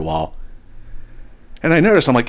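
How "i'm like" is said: ordinary talk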